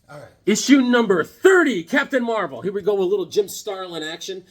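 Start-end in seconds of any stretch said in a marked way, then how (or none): 0.5-2.6 high, loud title announcement; announcer. Pretend. Host introducing Captain Marvel comic, excited announcer call. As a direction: belt out the issue number.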